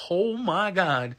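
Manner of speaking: slow, high, drawn-out awe; shocked reaction. Retold or imitated. Kid watching flesh fall off bones, shocked and drawn out. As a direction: stretch it out in mock horror.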